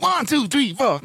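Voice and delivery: very high-pitched funk count-in. Retold or imitated. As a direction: count the band in with a high yelp. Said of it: "this kid called [name]".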